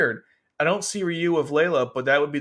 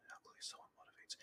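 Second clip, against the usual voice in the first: barely audible, rushed whisper; whispered reread; 31 dB quieter, faster than usual. Real, not acted. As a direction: reread it under your breath, quickly. Rereads garbled phrase under breath.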